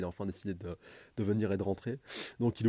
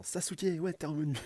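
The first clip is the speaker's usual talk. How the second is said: much higher, delighted; happy greeting; 8 semitones higher than usual. Acted. Naruto greeting Sasuke back at the office, high and delighted. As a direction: call out his name like a long-lost friend is back.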